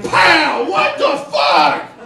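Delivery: sudden loud shout of pain and shock. Retold or imitated. Comic retelling his knee blowing out, loud shocked shout. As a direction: blurt it out as the knee pops. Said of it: "I'm like".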